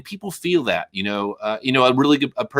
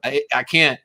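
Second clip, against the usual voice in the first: ordinary talk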